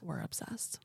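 breathy whispered aside. Acted. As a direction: whisper it conspiratorially. Whispered aside after ad line.

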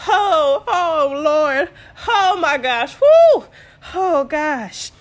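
loud, high, hammed-up "oh Lord" wailing; mock labor moaning. Mother-in-law moaning along with her contractions, mock agony. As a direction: imitate someone moaning through pretend contractions. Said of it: "as I'm moaning, going".

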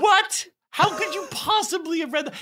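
very high-pitched retold disbelief; acted shock, pitch shooting way up; astonished. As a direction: squeal it as an incredulous reenactment. Retells his own astonished reply.

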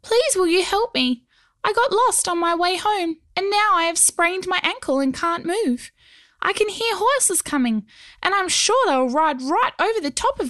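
high, small pleading creature; tiny goblin voice. Acted. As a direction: beg like a tiny stone goblin. Stone goblin pleads for help.

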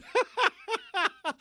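high scoffing laugh; sharp disbelieving laugh; incredulous laugh. Real, not acted. Laughs at senator quote.

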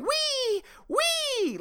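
very high squeaky mock "Wee, wee"; mock squeal.

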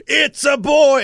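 put-on high, loud "It's a boy" called out; mock announcement shout. Friend acts out the father announcing to the waiting room, loud. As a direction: call it out proudly to an empty room.

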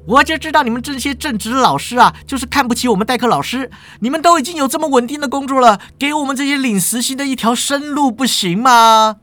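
high, fast aggrieved whine; shrill-free but high, quick sulky protest; whiny substitute teacher. Acted. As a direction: pitch it way up and rush the complaint like a wronged hourly lecturer. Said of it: "代课老师就说了".

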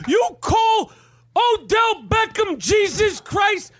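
high, slow, furious shouting rant; Danny Aiello impression. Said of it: "That's my Danny Aiello".

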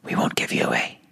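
soft, secretive whisper. A narrator's reading. Boys promising loyalty to Rag, whispered. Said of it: "we whispered to Rag".

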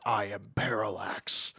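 slow, breathy villain whisper; ominous whisper. Retold or imitated. Villain Hal announcing his new name in a slow ominous whisper. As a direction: whisper the name like a dark reveal. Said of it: "he says".

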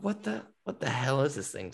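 softly baffled, as if under your breath; mock-stunned quiet double take; retold bewilderment. Startled at seeing a huge figure.

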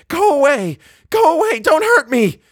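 very high, loud, terrified shrieking; wild scream. A narrator's reading. A child's voice begging not to be hurt, screaming. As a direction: scream to drive them off. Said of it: "it screamed".

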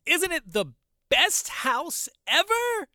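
boy boasts to passersby about his house, in a high, slow child imitation; proud little boy. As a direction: drag it out like a delighted kid. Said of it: "He's like".

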